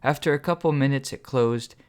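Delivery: ordinary delivery, nothing marked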